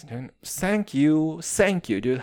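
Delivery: ordinary delivery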